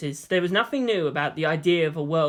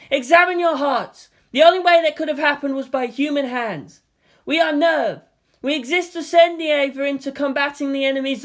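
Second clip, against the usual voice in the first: raised, solemn address to the crowd; lieutenant addressing the crowd, raised and solemn; stern lieutenant; 6 semitones higher, 3 dB louder than usual. Acted. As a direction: preach to the crowd.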